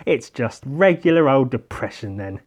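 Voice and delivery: ordinary talk, nothing marked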